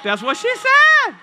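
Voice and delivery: high, loud, drawn-out holler; big comic shout; shouted punchline. Acted. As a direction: belt out the joke, stretching the words.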